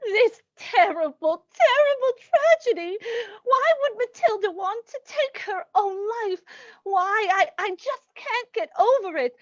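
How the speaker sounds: theatrical, weepy grief; anguished, bewildered mourning; tearful lament. Acted. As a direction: wail over a friend's death. Friend laments the aunt's death.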